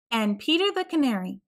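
ordinary delivery

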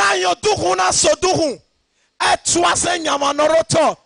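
loud, high, driving pulpit shout; fiery sermon cadence, ringing out; preaching at full force. Acted. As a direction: hammer each line home to the church. Preaching, bursting into tongues.

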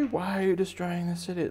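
high-pitched mock protest; protesting bystander. Bystander challenging the rampaging bunny, high mock protest. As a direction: question the monster in a high put-on voice.

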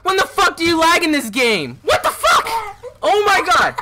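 high, incredulous rant; exasperated shouting. Real, not acted. Gamer complaining to a friend about lag, exasperated shouting.

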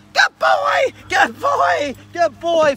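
high praise to a returning dog; excited dog praise. Real, not acted. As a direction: gush at the dog, high and bright. Praising dog after retrieve.

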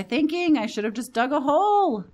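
loud, high-pitched exasperated outcry; panicked wail. Real, not acted. Player berating themself as night falls, panicked wail. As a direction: wail in regret at your own blunder.

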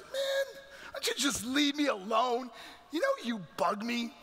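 acted irritated teenage outburst; angry outburst. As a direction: lash out to be left alone. Acted outburst at his mother.

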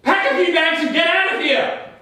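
high, urgent order; feigned panic. Pretend. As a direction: push them to leave in staged alarm. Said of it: "act as though someone is following me".

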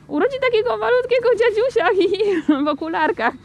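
put-on very high, squeaky child voice with giggles; giggling child. A narrator's reading. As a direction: squeal the silly idea, tittering. Child giggles at silly idea. (piskliwy, chichoczący dziecięcy głosik)

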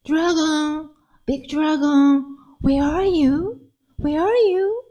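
high, slow call into the mountains; little girl calling out high. Acted. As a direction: call for the dragon far away. Lily searching the mountain.

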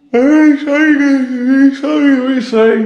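words stretched high through a yawn. Real, not acted. Vlogger who just woke up, yawning apology. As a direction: apologize mid-yawn, pitch riding up. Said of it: "I don't know why I'm yawning".

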